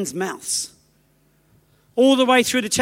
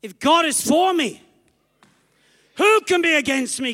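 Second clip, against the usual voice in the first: declared; 5 semitones higher than usual. Shown as a demonstration. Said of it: "You gotta say it".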